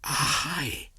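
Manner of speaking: high, bright cry of delight; eager exclamation. A narrator's reading. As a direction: Mowgli thrilled by the plan. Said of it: "said Mowgli".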